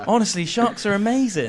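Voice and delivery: lightly voiced coerced shark fan; hobbling shark advocate. Man joking as the bullied shark victim praising sharks, mock-earnest. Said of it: "As he's, like, hobbling around".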